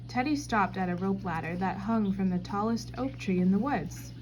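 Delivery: ordinary delivery, nothing marked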